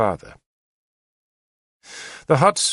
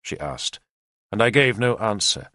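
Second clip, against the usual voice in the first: ordinary delivery, nothing marked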